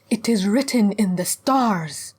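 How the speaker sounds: slow, high, ringing prophecy; solemn proclamation. Acted. Zealot hag proclaims her prophecy, solemn and fervent. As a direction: proclaim it like scripture, drawn out.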